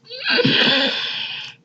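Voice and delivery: high shrieking laugh bursting out. Real, not acted. Telling the camera about her dream, shrieking laugh. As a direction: crack up with a squealing laugh.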